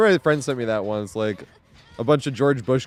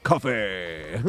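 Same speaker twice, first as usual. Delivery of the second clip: low cartoon smoker croak; Koffing's smoker voice; 5 semitones lower, 5 dB quieter than usual. Streamer mimicking a cartoon smoker, low croak. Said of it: "that smoker voice that the cartoon had".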